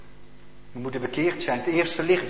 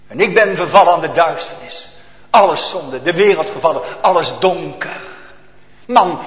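preaching at full force; 2 semitones higher, 5 dB louder than usual. Acted. Preaching sermon climax.

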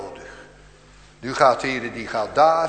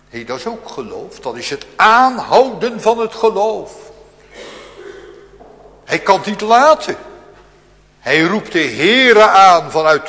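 fervent preaching; 8 semitones higher, 14 dB louder than usual. Acted. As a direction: drive every phrase home from the pulpit. Preaching climax on persistent crying.

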